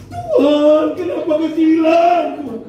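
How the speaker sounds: high, loud, hammed-up wailing prayer; mock crying wail. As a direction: wail at God like a weepy wife, half in jest. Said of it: "Tapi dia nangis".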